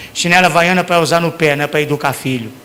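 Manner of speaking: normal talking voice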